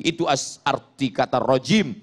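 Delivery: usual speaking voice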